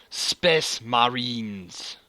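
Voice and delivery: slow, loud, drawn-out proclamation; space marine voice. Shown as a demonstration. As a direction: proclaim it slowly like a soldier. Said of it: "You gotta pronounce it properly".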